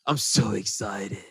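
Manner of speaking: very slow, low, soft deadpan; emo excitement. Retold or imitated. Edward telling Bella he's excited, very slow and lifeless. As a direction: drag out the excitement like it bores you. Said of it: "his excitement is hilarious because it's also emo".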